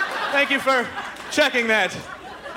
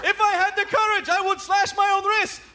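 much higher hammed-up TV pitchman voice; Crazy Gideon; 10 semitones higher than usual. Comedian imagining the ad, high manic pitchman. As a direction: sell it like an unhinged late-night huckster. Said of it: "come out and go".

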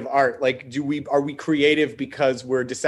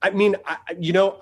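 ordinary delivery

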